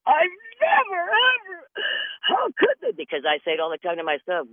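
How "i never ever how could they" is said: high, crying falsetto wail; hammed-up indignant cry; mock wail. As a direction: wail it like a wounded victim. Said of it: "you're going".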